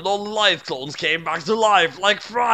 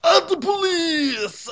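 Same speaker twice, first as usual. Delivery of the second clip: dramatic cry; 13 semitones higher, slower than usual. A narrator's reading. Narrator cries scene change.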